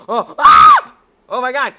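very high, loud frightened shriek; gasp, then scream in terror; shrieking at a jump scare; panicked scream. Real, not acted. Granny suddenly attacks the player.

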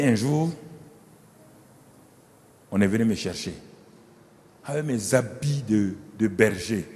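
low, soft fatherly voice to his son; old David, tender. Acted. Old David softly urging his son Salomon to succeed him. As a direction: hush it like a dying king confiding.

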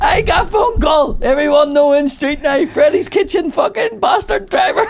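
high, loud mock shouting in broken English; hammed-up angry boss, laughing; impersonating the boss. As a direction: rant down the phone as the furious owner. Quoting the boss on phone.

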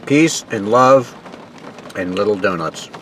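chewing while talking; speaking around food; mouth full. Real, not acted. Seen 3: Bites donut at -3; still chewing, lips pursed while speaking.

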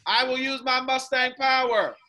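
loud, leading the chant; call-out line. Shown as a demonstration. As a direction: lead the line for kids to echo. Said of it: "continue to repeat after me".

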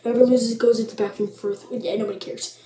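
ordinary delivery

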